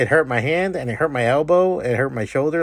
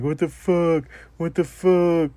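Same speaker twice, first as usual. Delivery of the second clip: higher-pitched mock whimper; imitated crying; 5 semitones higher, slower than usual. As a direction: imitate a guy close to tears. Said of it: "he's kinda crying too".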